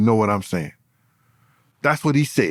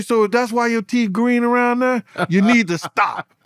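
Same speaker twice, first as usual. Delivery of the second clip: mocking roast; 7 semitones higher than usual. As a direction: drag it out to rub it in. His own retort, roasting rival.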